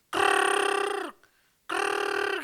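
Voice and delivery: rattling gurgle through a cut throat. Retold or imitated. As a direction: imitate air rattling from the neck. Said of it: "Suara keluar sini".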